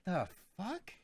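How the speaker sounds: retold high-pitched disbelief; baffled reaction. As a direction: act out being baffled by heckling.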